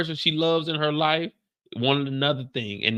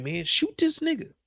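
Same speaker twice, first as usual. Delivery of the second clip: much higher, yelling at the screen; urging viewer shout; 14 semitones higher, 3 dB quieter than usual. Retold or imitated. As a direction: egg them on. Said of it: "And I'm like".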